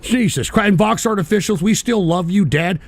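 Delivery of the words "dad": usual speaking voice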